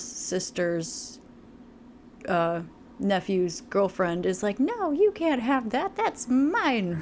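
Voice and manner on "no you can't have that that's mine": high, slow, bratty; childish possessive voice. Retold or imitated. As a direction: whine like a spoiled child. Mimicking a possessive girlfriend.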